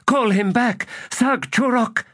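very high, urgent cry; shouting. A narrator's reading. Destriant begs Sag Chorok to recall the hunter, high shout. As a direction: call out desperately, pitch way up. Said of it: "Calith shouted".

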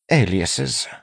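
mildly displeased, pitched high; dissatisfied. A narrator's reading. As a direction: report it with dissatisfaction.

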